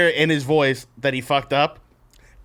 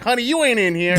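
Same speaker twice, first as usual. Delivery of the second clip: security lady; 8 semitones higher, faster than usual. Retold or imitated. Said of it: "and the lady's like".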